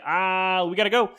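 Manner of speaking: flustered, rushing to leave; hurried alarm. Retold or imitated. Doctor hurrying off.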